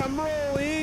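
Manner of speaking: put-on squeaky high baby talk. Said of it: "The baby's like".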